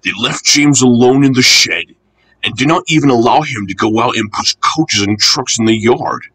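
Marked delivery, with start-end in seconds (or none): none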